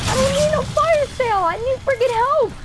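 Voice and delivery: high, loud cry for help; desperate yelling. Real, not acted. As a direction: yell it out desperately. Desperate in game.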